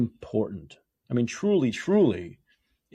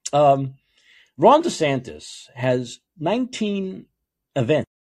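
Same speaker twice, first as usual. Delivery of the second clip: normal talking voice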